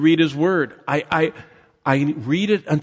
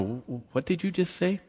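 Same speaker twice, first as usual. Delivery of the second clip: softly acted double take, pitched up; mock puzzled; 5 semitones higher, 7 dB quieter than usual. Distracted listener catching himself mid-conversation, mock puzzled. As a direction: stammer into a hushed, higher question.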